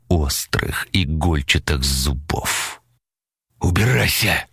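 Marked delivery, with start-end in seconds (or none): 3.6-4.5 higher, hoarse order to leave; furious rasp. A narrator's reading. Павел drives off the creature at the window, furious rasp. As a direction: rasp it out in rage. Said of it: "прохрипел Павел, его голос дрогнул от ярости".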